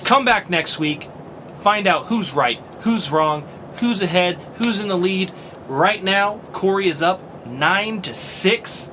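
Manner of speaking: rally listeners for next week; announcer outro. Acted. Podcast outro announcer read.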